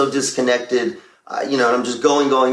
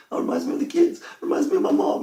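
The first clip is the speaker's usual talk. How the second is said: high, squeaky choked-up imitation of crying; mock tearful; 4 dB quieter, faster than usual. Dad explaining his tears to his wife, mock tearful. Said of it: "every song makes me cry".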